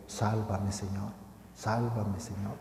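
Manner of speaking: softly repeated plea, fading almost to nothing; a soft murmured prayer to the Lord; quiet prayer. Shown as a demonstration. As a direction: pray it under your breath, humbly. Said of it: "Repitamos muchas veces esta oración".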